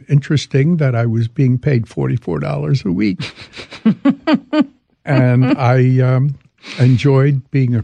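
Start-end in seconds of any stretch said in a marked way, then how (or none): 3.2-4.7 high wheezy chuckle; amused, high-pitched laughing. Real, not acted. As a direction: chuckle at your own story. Laughs at his small wage.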